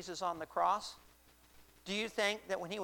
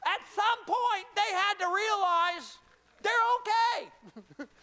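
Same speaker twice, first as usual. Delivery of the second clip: high, loud, stretched-out words; slow, belted pulpit exclamation; shouted preaching climax; 8 dB louder, slower than usual. Acted. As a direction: build to a drawn-out shout. Preacher builds to climax of story.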